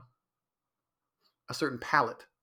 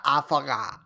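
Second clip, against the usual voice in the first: ditzy drawn-out mock girlfriend voice; 4 dB louder than usual. How his girlfriend talks in his head, ditzy mock voice. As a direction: say it like an airhead. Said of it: "That's how she talks in my head".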